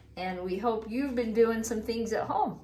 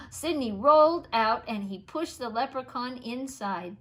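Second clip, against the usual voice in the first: plain reading voice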